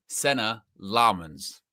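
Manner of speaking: Senna Laarmans slowly, SEN and LAAR stressed; correct Dutch pronunciation. Shown as a demonstration. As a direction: carefully demonstrate Senna Laarmans with first-syllable stress. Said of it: "how we pronounce it".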